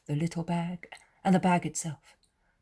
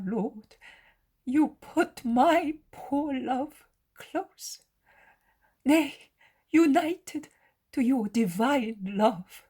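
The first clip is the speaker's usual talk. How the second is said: high, drawn-out, emotional wonder; overwhelmed with gratitude; 7 semitones higher, 5 dB louder, slower than usual. A narrator's reading. Mother thanks Jesus for his comfort, overwhelmed. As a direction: marvel slowly in a high, moved voice.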